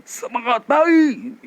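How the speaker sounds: slow, full belly-voice cheer; imitating Pak Heri. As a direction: do Pak Heri's supported greeting. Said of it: "Pak Heri ... sudah menguasai teknik". (menirukan Pak Heri dengan suara perut)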